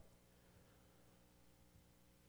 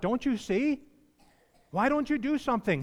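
high, pleading cry to God; pleading prophet; 10 semitones higher, 4 dB louder than usual. Retold or imitated. Preacher voicing Habakkuk pleading with God, high. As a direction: voice Habakkuk begging God to act. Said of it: "he's saying to God".

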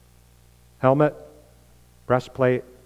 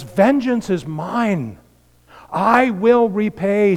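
slow, raised, emphatic preaching voice; solemn divine declaration; 7 semitones higher, 3 dB louder, much slower than usual. Acted. Preacher quoting God on vengeance to the congregation, solemn declaration. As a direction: proclaim it slowly and high, with authority.

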